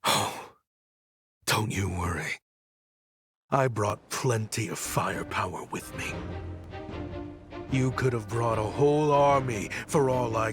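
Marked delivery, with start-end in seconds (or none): 0.0-6.1 soft, understated threat; quiet menace. Acted. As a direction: keep the threat hushed and controlled. Quiet threat before the attack.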